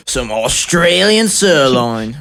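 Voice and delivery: slow, drawn-out mock Australian; exaggerated Aussie voice; Australian accent. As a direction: ham up a stretched Australian accent. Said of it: "who do you think in the world has the best accents".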